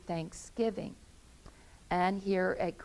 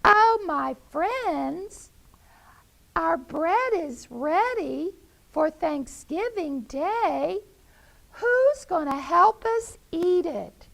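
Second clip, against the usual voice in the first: high, loud, sing-song hen calling; Henrietta the hen; 10 semitones higher, 6 dB louder than usual. Acted. Hen calling her friends to share her bread. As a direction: call out as a proud mother hen.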